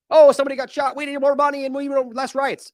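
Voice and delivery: high, loud fake-alarmed bureaucrat; mock their panic; mock official. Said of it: "every single time".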